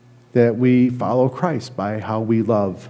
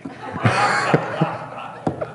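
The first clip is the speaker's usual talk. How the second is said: high, airy laugh at himself; nervous chuckle from the pulpit; breathy embarrassed laugh; 7 semitones higher, 4 dB quieter than usual. Real, not acted. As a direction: laugh off the awkward moment. Awkward moment about hard-to-love people.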